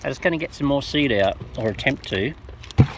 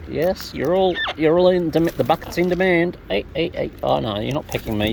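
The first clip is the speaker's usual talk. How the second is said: ordinary delivery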